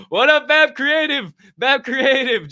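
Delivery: high, loud hype greeting; excited shout-out. Real, not acted. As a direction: call him out with big energy. Calling out to a chat viewer.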